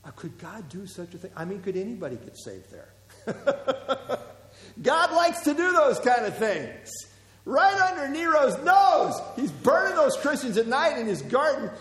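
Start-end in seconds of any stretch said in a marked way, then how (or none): 3.2-4.3 short amused laugh; a real chuckle. Real, not acted. Laughs at his own joke. 4.8-11.7 high, loud proclamation; rising sermon fervor; preaching at full force. Real, not acted.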